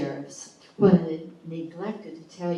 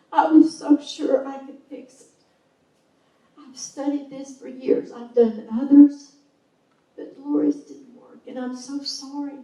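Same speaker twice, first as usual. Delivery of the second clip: retold crying apology; 9 semitones higher, 7 dB louder than usual. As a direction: plead through tears as the grieving doctor. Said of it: "all I could hear was crying".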